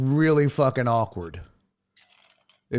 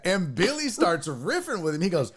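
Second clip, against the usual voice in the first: normal talking voice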